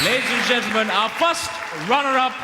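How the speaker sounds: rising announcer voice; pageant host. Real, not acted.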